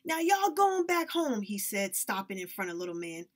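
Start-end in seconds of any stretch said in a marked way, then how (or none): 0.1-1.4 high, loud angry order; shouting. Acted. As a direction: bark them off his land. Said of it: "Mister Anderson shouted".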